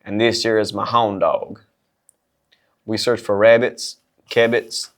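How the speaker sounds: deadpan Southern sergeant introducing himself; Southern accent. Acted. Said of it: "a little bit of Southern accent".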